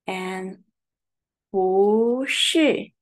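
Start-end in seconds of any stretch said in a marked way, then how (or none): 1.5-2.9 不是 with 不 rising then 是 falling; bu shi demo. Shown as a demonstration. Teacher modelling 不是 with rising 不. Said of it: "We pronounce the second tone".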